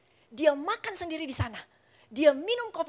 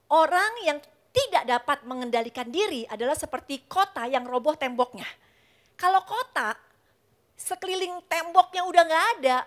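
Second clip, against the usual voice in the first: louder, higher sermon push; forceful preaching; 4 semitones higher, 6 dB louder than usual. Acted. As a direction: drive the point home to the congregation.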